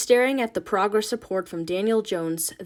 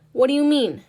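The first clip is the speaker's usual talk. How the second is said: higher, louder challenge; sharp demand; 5 semitones higher, 8 dB louder than usual. Acted. Ma challenging Nina, high and loud. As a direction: fire the question back.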